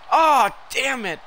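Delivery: loud, high, drawn-out curse; real gamer exasperation; frustrated cry. Real, not acted. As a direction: curse the failed move loudly. Move fails in game.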